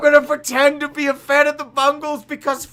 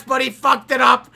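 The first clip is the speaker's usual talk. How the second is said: ordinary delivery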